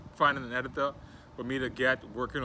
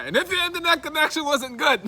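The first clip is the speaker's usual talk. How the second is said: high-pitched, raised-voice exclamation; loud joking rant; 8 dB louder than usual. Real, not acted. Host jokes with viewers about bad internet, high and loud. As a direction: shout it way up high.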